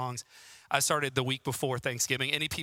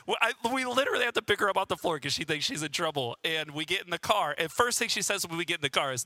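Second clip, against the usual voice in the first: usual speaking voice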